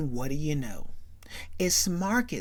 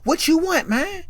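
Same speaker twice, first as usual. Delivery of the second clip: higher, louder baffled yell; confused shout; 12 semitones higher, 8 dB louder than usual. Retold or imitated. Man who can't understand asks loudly. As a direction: bark it like you can't believe him. Retold movie character confused.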